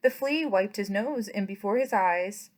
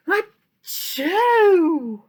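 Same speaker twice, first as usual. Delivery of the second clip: big loud sneeze "achoo!" cried out high; 7 semitones higher, 10 dB louder than usual. Acted. The flea sneezes and wakes the farm, big sneeze. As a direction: let the sneeze burst out full. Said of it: "before he could cover his snoot".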